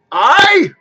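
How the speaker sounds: sudden loud cry, pitched high; cried out. A narrator's reading. Spirit answers Scrooge with a booming cry. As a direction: bellow a single hearty aye. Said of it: "cried the spirit".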